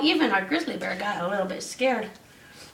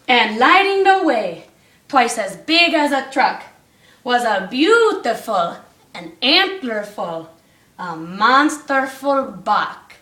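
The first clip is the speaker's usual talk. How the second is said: rhythmic read-aloud storytelling; bouncy storybook reading; story narration; 3 semitones higher, 4 dB louder, slower than usual. A narrator's reading. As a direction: read the rhyme to the class. Reads rhyming Christmas story aloud.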